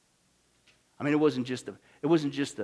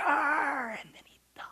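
whispered, slow, tearful; breaking whisper; 11 semitones higher, slower than usual. Acted. Preacher to congregation ending the story, tearful whisper. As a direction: let the voice crack into a whisper. Hushed end of deathbed story.

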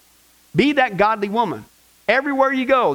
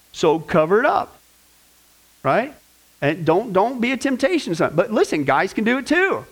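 ordinary talk

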